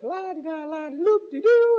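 high, slowing sing-song babble; mock wife chatter. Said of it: "she's like".

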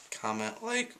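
squeaky voice from a sore throat. Real, not acted. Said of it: "my voice is squeaky today".

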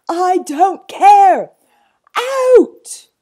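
slow, high, enormous bellow; furious shout. Acted. Zoo owner dismissing boy and zookeeper, furious shout.

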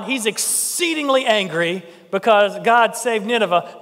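normal talking voice

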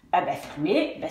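ordinary talk, nothing marked

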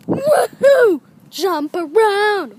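loud, high cheer; gleeful shout; excited whoop. Acted. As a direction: whoop and holler, stretching the words. Whooping while jumping.

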